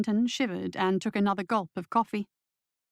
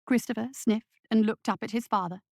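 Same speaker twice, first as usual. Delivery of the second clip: ordinary delivery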